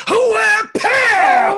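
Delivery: high, loud battle yell; loud gung-ho soldier. Acted. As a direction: yell it mid-attack. Attacking with the toilet lid.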